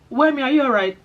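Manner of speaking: mildly acted check-in, lifted questioning voice; concerned colleagues. Colleagues at her placement checking on her, lightly acted concern.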